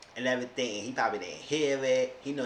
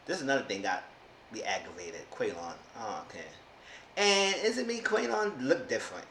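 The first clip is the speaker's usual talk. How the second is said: usual speaking voice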